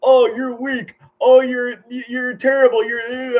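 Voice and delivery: mock troll taunts; mocking insults. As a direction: imitate online trolls jeering. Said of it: "putting people down".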